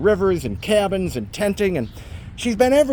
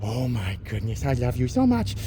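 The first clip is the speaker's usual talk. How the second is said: talking to a dog; 5 semitones lower, 5 dB quieter than usual. Real, not acted. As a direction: melt over the dog softly. Praising the dog.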